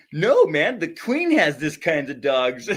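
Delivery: lightly mocked proud dog owner; offended neighbor. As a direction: play the huffy owner defending his dog. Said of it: "He was like".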